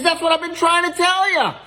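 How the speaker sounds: high-pitched, exasperated cry; exasperated outburst. Acted. Ice cream seller to a kid who won't listen, high exasperation. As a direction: burst out high at a stubborn customer.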